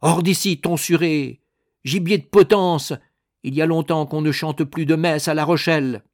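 high, loud zealot's tirade; loud, scornful shouting; angry shout. A narrator's reading. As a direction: yell insults as you shove him out. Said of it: "le repoussa en lui criant".

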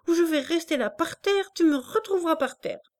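put-on a child's tearful threat; crying child. A narrator's reading. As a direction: threaten through tears. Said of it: "Elle pleurait".

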